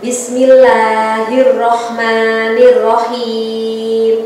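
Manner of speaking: usual speaking voice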